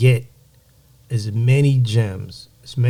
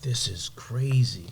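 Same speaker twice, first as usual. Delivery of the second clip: slow unvoiced whisper; 7 dB quieter, slower than usual. Amazed at the viral interview, whispering slowly. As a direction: breathe it out in awe.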